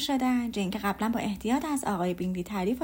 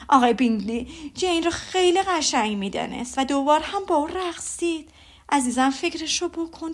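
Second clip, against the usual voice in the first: a mother bursting with ball gossip; telling her husband all about the ball, gushing mother; gushing Mrs Bennet; 3 semitones higher than usual. Acted. Mrs Bennet recounting the dances.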